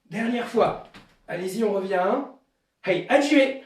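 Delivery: barks the counts loud like a sensei leading the class; shouted dojo commands. Shown as a demonstration. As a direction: give each count a sharp, loud call. Leads dojo drill with shouted counts. (commandes de dojo criées, comptes lancés fort comme un sensei)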